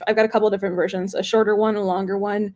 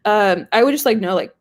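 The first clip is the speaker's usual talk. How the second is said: ordinary talk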